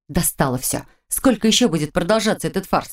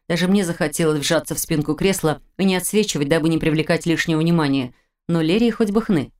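usual speaking voice

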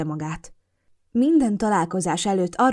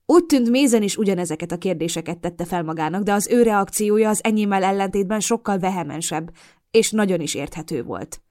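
ordinary delivery, nothing marked

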